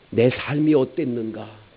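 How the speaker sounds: ordinary delivery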